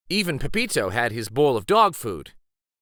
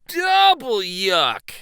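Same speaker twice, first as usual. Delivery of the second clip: high, loud, slow drawn-out double yuck; exaggerated disgust; 9 semitones higher, 6 dB louder, slower than usual. Sophia answering Maddie about fish in backpack, mock disgusted. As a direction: stretch out the disgust, hamming it up.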